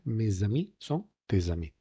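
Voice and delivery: MES AMIS, TES AMIS linked with a Z; voiced S liaison. Shown as a demonstration. Teacher showing the commonest case, MES AMIS and TES AMIS joined by buzzing Z. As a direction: say "may-za-mee", "tay-za-mee" for MES AMIS, TES AMIS. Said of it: "suena una S además sonora".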